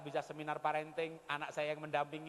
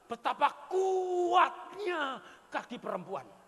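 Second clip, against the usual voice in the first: berteriak sambil tertawa; lantang, lambat, tergelak; tertawa takjub; 8 semitones higher, 6 dB louder, much slower than usual. Real, not acted. (seruan takjub sambil tertawa)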